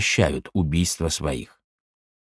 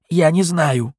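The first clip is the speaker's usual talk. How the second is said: under torture; 8 semitones higher, slower than usual. Acted. Prisoner answers under torture.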